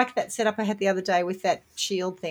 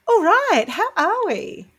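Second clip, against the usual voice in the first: bright, raised hello; cheery greeting; 11 semitones higher, 6 dB louder, slower than usual. Real, not acted. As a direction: open the show with a lift. Host opens the chat loudly.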